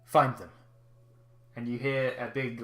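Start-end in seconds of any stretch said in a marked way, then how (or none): 0.1-0.5 louder barked orders to troops; commanding soldier. Acted. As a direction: order the search party. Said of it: "you hear a voice quite clearly say".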